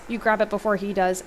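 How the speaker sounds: ordinary delivery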